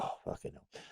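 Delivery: whispered under the breath; whispered dismay at the news; whispered curse. Retold or imitated. Quiet aside to himself.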